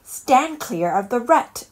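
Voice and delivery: bossy squirrel giving orders; instructing. Acted. Squirrel orders Olive to stand clear, instructing loudly. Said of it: "instructed a squirrel".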